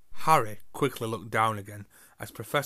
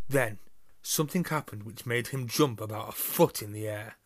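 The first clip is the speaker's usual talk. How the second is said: ordinary delivery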